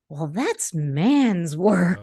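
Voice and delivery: slow, drawn-out grandmotherly pronouncement; mock grandmother objection; voiced grandmother. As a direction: lay down the rule like grandma, slowly. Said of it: "my grandmother would say".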